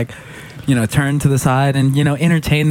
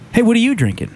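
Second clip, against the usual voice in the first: high, friendly mock inquiry; lightly acted, pitched-up question; chatty bar stranger; 10 semitones higher than usual. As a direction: imitate a stranger striking up conversation.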